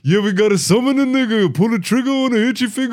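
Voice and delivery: high-pitched, hammed-up rap delivery. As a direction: chant it like a parody rap hook. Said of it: "some fucking [name] lyric".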